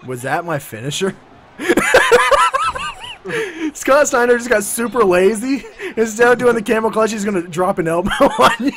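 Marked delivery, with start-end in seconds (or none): 1.6-3.7 high shrieking laugh. Real, not acted. Burst of laughter.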